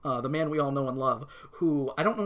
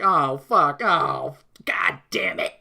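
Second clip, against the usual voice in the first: mock swearing; 3 semitones lower, 5 dB louder, much slower than usual. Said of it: "do not say so many swear words".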